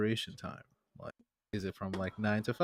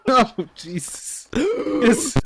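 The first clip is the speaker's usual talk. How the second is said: high, loud laughing yelp; laughing outburst; 13 semitones higher, 11 dB louder, much slower than usual. Real, not acted. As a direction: shriek through laughter.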